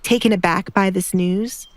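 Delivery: ordinary delivery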